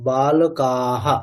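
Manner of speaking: বালকাহা stretched slowly, every syllable drawn out; slow model word. Shown as a demonstration. Teacher models বালকাহা slowly for the class. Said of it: "না, এটা বালক".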